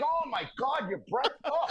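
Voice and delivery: laughing imitation of revulsion, starting high; mock disgust. Player re-enacting his rival's reaction amid laughs, mock disgusted. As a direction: play repelled, breaking off in a groan. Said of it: "He was just disgusted".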